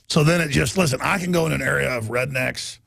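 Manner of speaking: usual speaking voice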